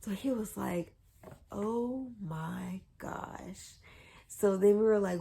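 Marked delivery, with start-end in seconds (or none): 1.5-3.7 very slow, drawn-out amazement; retold stunned realization. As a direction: stretch each word as it sinks in. Retold dad realizing the news.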